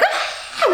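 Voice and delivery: very high mock shriek; high wail imitating a baby screaming in pain; imitated screaming. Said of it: "she's screaming like".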